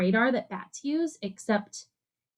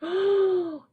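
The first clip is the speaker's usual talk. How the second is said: mock gasp; 8 semitones higher than usual. Teacher builds suspense for kids.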